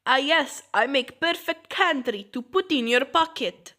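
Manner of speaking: proud cartoonish Russian accent; awful fake Russian accent. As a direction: put on a bad Russian accent. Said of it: "my awful fake Russian accent".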